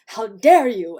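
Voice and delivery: loud, outraged burst; indignant turtle. Acted. Stubborn turtles protesting being put back, in an indignant burst. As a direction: voice an affronted turtle. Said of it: "they're gonna be like".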